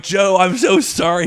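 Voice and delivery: high, loud, over-the-top sorry; loud apology. Retold or imitated. Comedian apologizing to boss Joe, loud and high.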